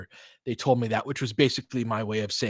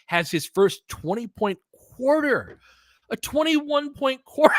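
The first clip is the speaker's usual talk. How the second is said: much higher, slow, building hype; excited call; 13 semitones higher, 3 dB louder than usual. Real, not acted. Fan hyping a twenty-one-point quarter, excited. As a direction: announce the milestone with rising thrill.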